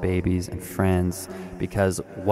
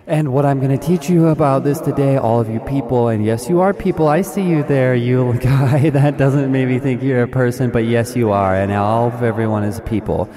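louder, pumped-up seminar speaker; guru addressing crowd; 3 semitones higher, 5 dB louder than usual. Acted. Guru hyping his audience, loud and pumped. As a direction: work the room like a motivational guru.

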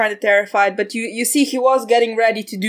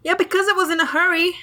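defensive; 9 semitones higher, faster than usual. Acted. Defensive hunter.

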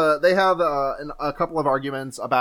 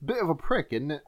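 soft mock-British accent, trailing quieter; hushed put-on Brit; 7 dB quieter than usual. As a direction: try out a British accent under your voice. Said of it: "heard British people say prick".

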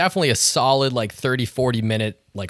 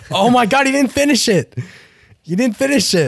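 much higher, loud disbelief; shocked shout; 12 semitones higher, 5 dB louder than usual. Real, not acted. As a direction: yell in outraged disbelief. Friend yells at unfinished chug.

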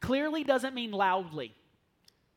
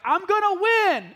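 higher, louder, slow teasing vow to win; mock defiant; 10 semitones higher, 10 dB louder, slower than usual. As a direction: call it down the stairs like a playful challenge. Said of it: "Jenna will go upstairs and be like".

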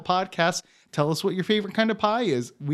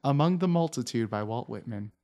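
ordinary talk

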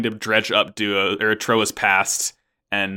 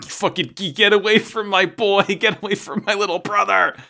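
high, hammed-up frantic shriek-lite; mock desperate plea, pitched way up; protective sister; 10 semitones higher than usual. Host acts Catherine defending her little brother, mock frantic. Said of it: "Catherine's like".

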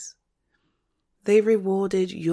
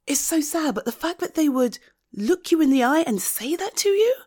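higher, insistent protest; heated indignation; 8 semitones higher, 3 dB louder than usual. Acted. Friend defending someone rejected by parents, indignant. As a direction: raise the pitch, press each point home.

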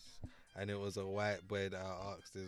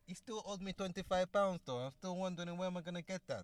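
Italian-American mobster; 9 semitones higher than usual. Pretend. British actor complaining about Tony in character, Italian-American mobster. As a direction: grumble about a debt as a mafioso. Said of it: "That got real Italian".

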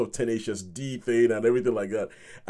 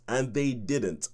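usual speaking voice

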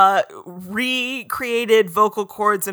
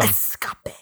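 low whisper; 9 dB quieter than usual. Acted. Echoing word dramatically.

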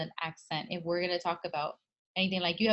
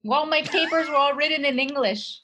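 raised, high-pitched incredulity; louder and higher, appalled at the absurdity; loud disbelief; 8 semitones higher, 9 dB louder than usual. Real, not acted. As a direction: exclaim it in loud, exasperated disbelief. Incredulous recounting of professor's question.

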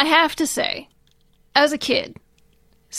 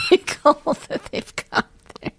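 real laughter breaking up the words; laughing while talking; 4 dB quieter than usual. Real, not acted. Podcaster describing the eagle statue, laughing through the words. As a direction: crack up and squeeze the words out breathlessly.

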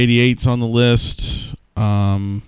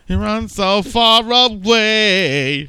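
much higher, slow and stretched out; mock dramatic; 10 semitones higher, much slower than usual. Joking that Kyle flees, high and slow drawn out. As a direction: draw it out high like a cartoon.

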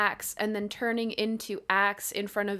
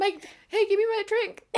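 high, louder whiny demand; mock protest; 12 semitones higher, 5 dB louder than usual. Friend demanding the drink back, comic high-pitched protest. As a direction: play up a petty objection.

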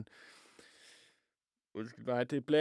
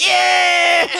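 imitating rock shout; 5 dB louder than usual. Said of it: "der råber".

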